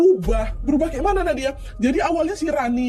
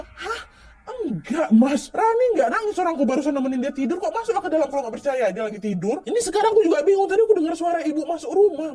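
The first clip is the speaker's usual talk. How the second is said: scared and insistent; 6 semitones higher than usual. Retold or imitated. Scared girl arguing with brother.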